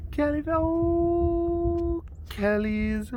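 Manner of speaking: squeaky high coo at the cat. Real, not acted. Owner cooing high at her cat.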